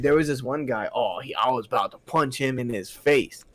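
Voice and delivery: usual speaking voice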